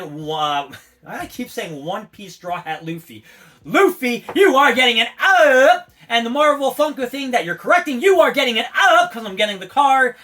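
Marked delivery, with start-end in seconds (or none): 3.6-10.1 loud hype shout-out. Real, not acted. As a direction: hype them up like a crowd. Shouting out to a card seller.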